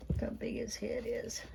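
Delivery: unvoiced whisper of the same words; hushed fond whisper. Real, not acted. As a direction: breathe it out to the puppy. Repeated as a whisper.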